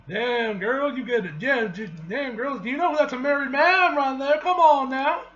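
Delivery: loud, high-pitched playful scolding; big, whooping reaction to juicy gossip; excited outburst. Real, not acted. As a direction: holler it in amused disbelief. Mock scolding reaction to the comic.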